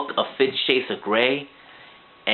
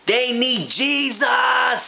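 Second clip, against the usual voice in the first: mock preacher shout; 9 semitones higher, much slower than usual. Reviewer mock-preaching to the audience, slow shouted cry. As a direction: bellow it like a revival preacher.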